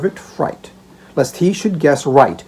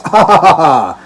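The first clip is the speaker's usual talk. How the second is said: laughing delight; 3 semitones higher, 9 dB louder than usual. Acted. Old father sniffing the basket, laughing with relish. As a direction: chuckle and savour the smell. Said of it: "inhaling laughing gas".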